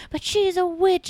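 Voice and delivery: very high, drawn-out kooky lady; mock whining accusation. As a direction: protest slowly in a put-on high voice.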